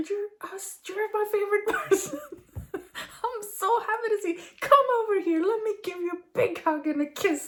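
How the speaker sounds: very high, laughing, bursting with joy; gushing over-the-top greeting. Pretend. People greeting each other like the dog, gleeful laughing squeal. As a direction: greet them like a thrilled puppy. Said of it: "what if we're like".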